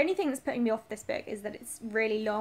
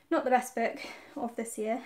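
usual speaking voice

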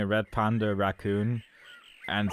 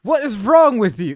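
higher, louder, slowed disbelief; incredulous outburst; 13 semitones higher, 7 dB louder, slower than usual. Real, not acted. Player scolding the horse over its slow time, in loud disbelief. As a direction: stretch out the disbelief. Reacting to a bad race time.

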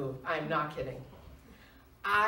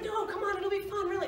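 much higher, eager wheedling; playful pleading; 12 semitones higher than usual. Retold or imitated. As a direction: talk her into it cheerfully.

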